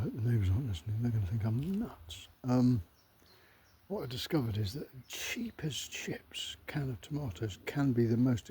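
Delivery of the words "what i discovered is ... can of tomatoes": hushed secretive whisper. Real, not acted. To his viewers, hoping the neighbours don't hear, whispering. Hopes neighbours aren't listening.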